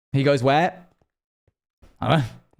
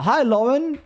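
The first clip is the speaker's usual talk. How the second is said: higher-pitched screen-dog voice; imitated AI dog; 10 semitones higher than usual. Retelling the screen dog's greeting to Laura, high mimicking voice. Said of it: "And he goes".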